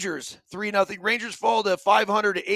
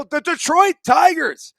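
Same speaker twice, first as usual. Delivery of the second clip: higher and louder, like calling a big name; hyped announcer call; 7 semitones higher, 7 dB louder than usual. Real, not acted. Host announcing the Tigers as the best team, hyped up.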